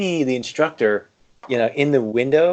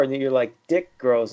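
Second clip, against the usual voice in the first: ordinary talk, nothing marked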